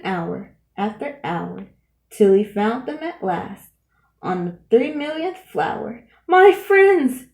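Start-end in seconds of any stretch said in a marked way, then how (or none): 6.3-7.2 much louder, higher cry of relief; joyful cry. A narrator's reading. Horton crying to his found friends, loud and high. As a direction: cry out with joy at finding them. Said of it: "cried Horton".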